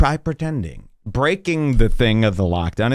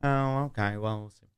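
dismissive manager; 9 dB quieter than usual. Retold or imitated. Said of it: "she goes".

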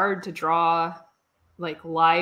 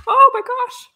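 sudden high, loud alarm; real startled cry; 10 dB louder than usual. Real, not acted. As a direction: caught off guard by the ink. Nearly dropping the ink.

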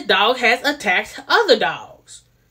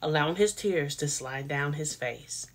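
said much softer and held back; much softer, drawn out; 7 semitones lower, 12 dB quieter than usual. Shown as a demonstration. Vlogger rephrasing for viewers, much softer. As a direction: tone it down, soft and slow. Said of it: "I'm gonna say it like that".

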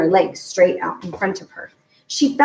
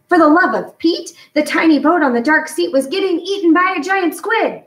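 alarmed surprise; 9 semitones higher, 3 dB louder than usual. A narrator's reading. Sudden horror at painting.

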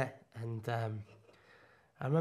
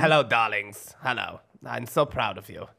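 low doting darling voice; Sarah Hanson; 7 semitones lower than usual. Acted. Greeting the darlings with pride, low doting impersonation. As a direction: gush proudly in a low put-on voice. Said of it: "Sarah Hanson".

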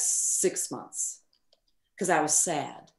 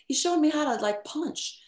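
normal talking voice

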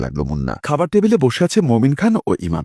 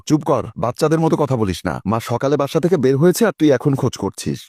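ordinary delivery

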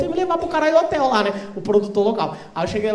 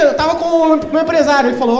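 usual speaking voice

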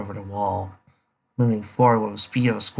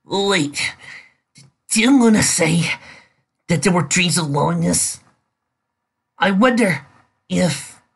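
out of breath; 7 semitones higher than usual. Acted. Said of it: "she panted a little".

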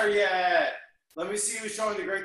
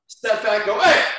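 shouted kiai; 9 dB louder than usual. Real, not acted. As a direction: punch out the yell. Self-defense punch command.

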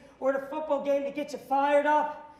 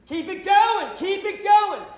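higher, louder, emphatic cheer; excited owner shout; 7 semitones higher, 11 dB louder, slower than usual. Retold or imitated. Team owner shouting to keep the lemonade guy going, loud. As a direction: boss yelling approval, all in.

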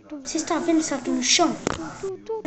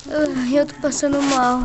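ordinary delivery

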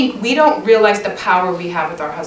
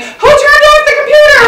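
high, loud call through the house; retold loud, high household call; 9 dB louder than usual. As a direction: yell the question so the whole house hears.

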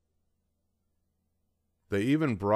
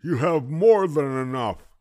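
mumbling toothless grandpa; 7 semitones higher, 8 dB louder than usual. A narrator's reading. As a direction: mumble it through gums. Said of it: "he mumbled".

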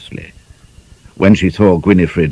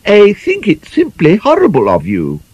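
shocked prim lady. Acted. Gwynifred shocked.

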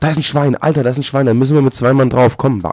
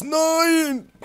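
high startled cry of no; panicked no. Real, not acted. Gamer panicking at the screen, startled high shriek. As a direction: shriek it out in alarm.

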